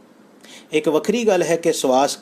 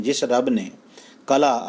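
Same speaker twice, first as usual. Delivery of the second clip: usual speaking voice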